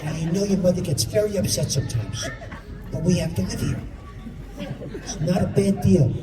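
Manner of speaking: put-on father confiding in his twelve-year-old son, hushed. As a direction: keep it down so mother won't hear. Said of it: "He looks at me and goes".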